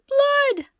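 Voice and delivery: very high, loud cry; shrieked alarm. Acted. Mother yelling at the sight of blood, very high and loud. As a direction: scream it in alarm. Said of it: "Loretta's father yelled".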